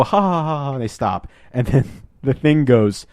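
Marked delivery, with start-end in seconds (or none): 0.0-0.7 high, spelled-out fake laugh; hammed-up "ha ha ha" trailing on; mock group laughter. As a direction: imitate a cheesy ensemble chuckle. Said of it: "And they all go".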